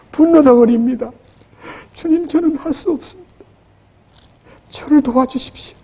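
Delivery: high, pleading cry to the Lord; pleading prayer. Shown as a demonstration. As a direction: beg for help, voice lifted. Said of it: "주님을 불러야 됩니다". (높은 목소리로 간절히 부르짖는 기도)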